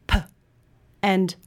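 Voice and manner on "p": unvoiced puff for "p"; isolated P sound. Shown as a demonstration. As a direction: say "p" as just a burst of air, no voice. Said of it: "difference between the".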